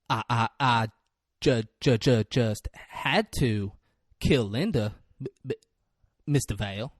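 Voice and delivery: low, halting stammer; stuttering kid. Retold or imitated. Retelling Aaron's confession to Mister Vale, haltingly stuttered. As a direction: imitate a stutter, catching on first sounds. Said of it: "This stuttering little sad kid".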